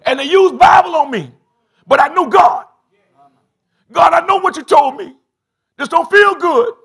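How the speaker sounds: high, loud climactic preaching; full-force preaching. Acted. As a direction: drive it home to the congregation.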